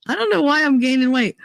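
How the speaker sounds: high, quick imitation of a baffled friend; mock bewildered friend. Friend wonders about her weight gain, mock bewildered.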